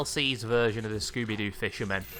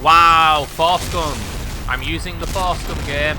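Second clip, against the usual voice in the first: excited; 5 semitones higher, 13 dB louder, slower than usual. Real, not acted. Thrilled at finding new weapon.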